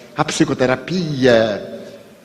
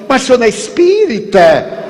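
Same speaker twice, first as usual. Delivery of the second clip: high, louder incredulous question; astonished stranger; 9 semitones higher, 5 dB louder, faster than usual. Retold or imitated. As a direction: stranger amazed a spiritist has problems, high and loud.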